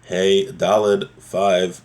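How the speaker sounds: normal talking voice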